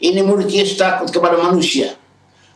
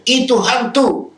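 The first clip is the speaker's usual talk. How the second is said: high, slow mock cry of fright; preacher hamming up a terrified disciple; startled cry; 7 semitones higher, slower than usual. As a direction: cry out like a disciple who sees a ghost. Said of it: "mereka terkejut dan berseru".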